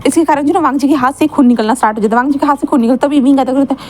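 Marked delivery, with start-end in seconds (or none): none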